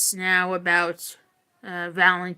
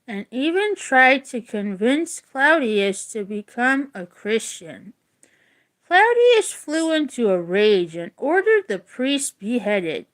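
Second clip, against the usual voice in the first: steady read-aloud voice, a touch livelier than chat; reading a story aloud; 3 semitones higher, 4 dB louder than usual. Acted. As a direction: read the legend clearly to the class. Teacher reads story to class.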